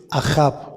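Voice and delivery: "Ahab" accented on the second A; stress on second A. Shown as a demonstration. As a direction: say "Ahab" slowly, stressing the last syllable. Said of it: "con acento en la segunda A".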